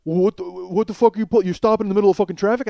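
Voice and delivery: sputtering, rapid irritated demand; angry confrontation. Retold or imitated. Passenger berates the man blocking his lane, angry. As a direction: trip over the start, then rush it out indignantly.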